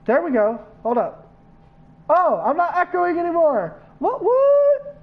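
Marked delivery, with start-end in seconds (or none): 2.1-5.0 high delighted surprise; surprised. Real, not acted. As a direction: squeal of surprise, higher. Echo suddenly stops working.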